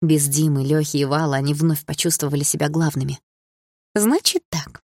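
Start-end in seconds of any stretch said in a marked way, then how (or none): 3.9-4.8 put-on high, gloating mean-girl voice; smirking proclamation. A narrator's reading. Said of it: "с ухмылкой провозгласила Малиновская". (издевательское торжество)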